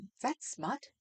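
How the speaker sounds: a hint of scandalised Jesus, hushed. Reading app voices Jesus asking about smut, hushed. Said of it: "I have Jesus going".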